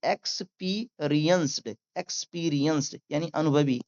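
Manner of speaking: ordinary talk, nothing marked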